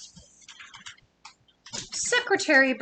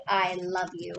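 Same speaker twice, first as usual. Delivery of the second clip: soft, lower i love you; tender i love you; 5 semitones lower, 5 dB quieter than usual. Acted. Reading to kids, the whale saying it tenderly. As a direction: breathe it softly to a loved one.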